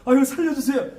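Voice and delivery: high-pitched begging client; mock pleading. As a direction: beg for your life, hammed up. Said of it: "제가 집에 뭐라 그래?".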